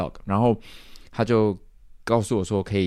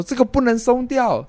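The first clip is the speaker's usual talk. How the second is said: much higher mock warning; warning bosses; 14 semitones higher than usual. Imitating the bosses warning performers about wristbands, higher. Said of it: "老板们都在说".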